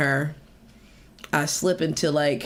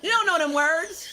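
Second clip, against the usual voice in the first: high sassy put-down; mock scolding; 10 semitones higher than usual. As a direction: repeat the tease, high and sassy. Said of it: "I'm like".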